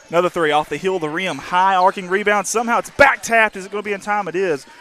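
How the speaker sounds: excited. Real, not acted. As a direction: build with the scramble. Scramble under the rim.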